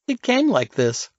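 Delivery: bright, high and breezy, cheerful. A narrator's reading. Girl shrugging off the messy room to Jones, cheerful. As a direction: toss it off playfully, cheerfully. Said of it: "Alice said cheerfully".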